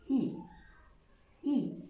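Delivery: "إي" with spread lips, like a smile; smiling e. Shown as a demonstration. Teacher drilling the é sound. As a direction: hold the smile on "إي". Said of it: "كأني ببتسم".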